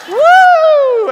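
extremely high, loud "woo"; piercing cheer whoop. Retold or imitated. Preacher showing how loud the cheerleaders were, piercing whoop. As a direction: shriek it like a cheerleader at a game. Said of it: "I mean, they're loud, piercing".

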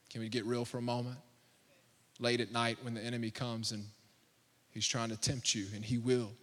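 soft, low, sincere pastoral plea; intimate quiet appeal. Acted. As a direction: lean in and speak gently to the congregation.

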